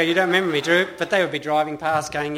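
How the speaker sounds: loud laughing mock question. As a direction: laugh out the quote. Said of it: "They go".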